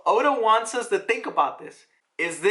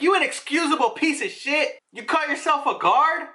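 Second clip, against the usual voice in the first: higher, yelled insult at a guard; mock furious yell; 8 semitones higher than usual. Noble berating a useless guard, higher mock yell. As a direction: scream at the guard like a spoiled noble. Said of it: "he basically yells at one of the guards".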